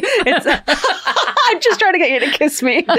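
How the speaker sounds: high-pitched, quick, through giggles; laughing. Real, not acted. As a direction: crack up while getting it out. Cracking up over silly handshake bit.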